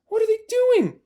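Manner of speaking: high, piping little fox asking; high child fox voice. Acted. Small fox asking in alarm, high voice.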